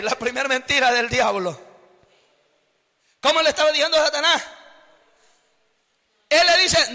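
ordinary talk

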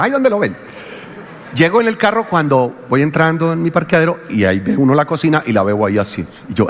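normal talking voice

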